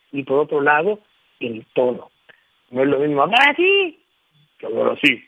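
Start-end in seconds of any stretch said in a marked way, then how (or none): 2.7-4.0 bright raised-pitch take of "así"; higher tone. Shown as a demonstration. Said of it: "El tono de voz". 4.6-5.2 "que hablar así" dropped much lower. Shown as a demonstration. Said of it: "El tono de voz".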